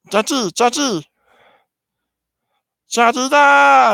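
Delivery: very high alien chatter building to a yell; Six Two Six. Acted. Alien chattering at a frog, high squeal rising to a shout. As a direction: squeal the gibberish, stretch the last syllable loud. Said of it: "He says".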